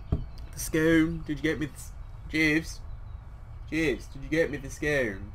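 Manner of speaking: lightly put-on posh English accent, slowed; terrible English accent. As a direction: play a lord ordering his butler in English accent. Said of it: "That's a terrible English accent".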